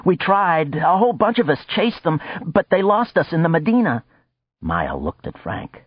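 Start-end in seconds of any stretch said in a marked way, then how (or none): 0.1-4.0 high, pressed explanation; agitated. Acted. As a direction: Sax defending himself, high and agitated. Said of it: "never seen the little man so agitated".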